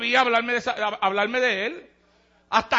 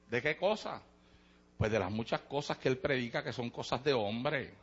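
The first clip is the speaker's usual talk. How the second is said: much softer, lower church-pew chatter; hushed gossip; 9 semitones lower, 11 dB quieter than usual. Acted. Two church sisters gossiping before service, much softer and lower.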